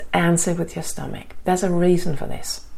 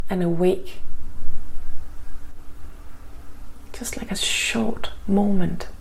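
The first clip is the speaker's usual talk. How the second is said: guided meditation. Acted. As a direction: ease them into calm, gently.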